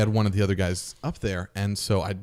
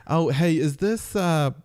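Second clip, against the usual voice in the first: lightly camp, pitched up; 7 semitones higher, slower than usual. Retold or imitated. Buddy greeting her on speaker in a camp voice. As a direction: keep the flamboyant act going.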